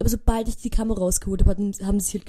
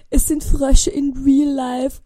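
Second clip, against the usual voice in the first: higher, louder, slow astonished cry; amazed discovery; 5 semitones higher, 7 dB louder, much slower than usual. Retold or imitated. Realising the frog sounds are real, slow amazed exclamation. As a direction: stretch it out in disbelief.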